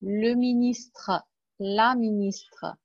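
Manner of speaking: Le ministre and la ministre said very slowly, each syllable apart; slow, clear model words. Shown as a demonstration. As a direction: drill it slowly for the class. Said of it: "teacher models slowly".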